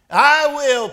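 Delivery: loud, high volunteering cry, hardly a purr; eager story cat. A narrator's reading. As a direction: jump in as the cat, voice leaping up. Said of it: "purred the cat".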